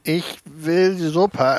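slow, drawn-out sick whine; whiny sick voice. Retold or imitated. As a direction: whine it like a pampered patient. Sick Sheldon whining for soup.

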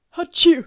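loud sudden sneeze; big explosive sneeze; sneezing. Real, not acted. Outtakes full of sneezes.

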